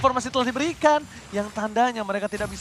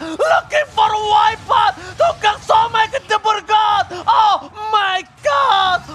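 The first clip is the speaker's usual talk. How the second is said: high, slow hype shout; fighting game announcer; 9 semitones higher, 5 dB louder, slower than usual. Real, not acted. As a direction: scream the wipeout call. Caster screams during wipeout.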